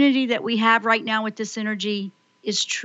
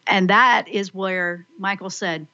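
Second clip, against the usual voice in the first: ordinary delivery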